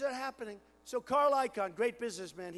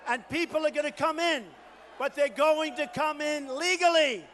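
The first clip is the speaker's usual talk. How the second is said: rally preaching; 5 semitones higher, 7 dB louder than usual. Acted. Candidate rousing the Oklahoma crowd, loud shouted rally. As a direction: whip the crowd up, full force.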